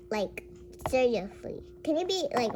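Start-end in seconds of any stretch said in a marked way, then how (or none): none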